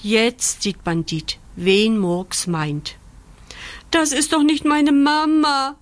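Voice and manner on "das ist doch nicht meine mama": high, loud puppy howl; disappointed wail. A narrator's reading. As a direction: wail in let-down protest. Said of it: "heult er enttäuscht".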